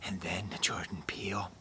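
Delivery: low, hushed playful whisper; softly whispered, as if for ASMR; mock ASMR whisper. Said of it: "I feel like I'm whispering".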